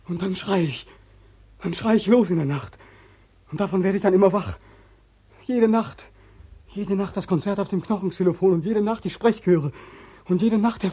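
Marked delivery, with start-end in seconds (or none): none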